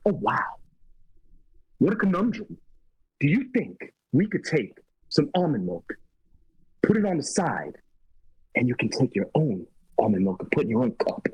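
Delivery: halting delivery with odd pauses; mannered, stop-start celebrity impression; Christopher Walken. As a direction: leave long gaps between phrases, pitch jumping. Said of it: "I can't do a Christopher Walken impression".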